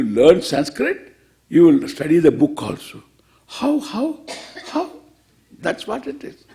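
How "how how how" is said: puzzled whisper, breathy and high; whispered question. Retold or imitated. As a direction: whisper the question like a baffled pupil. Teacher acts out a puzzled question.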